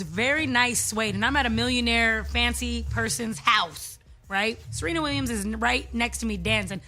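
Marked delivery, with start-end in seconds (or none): none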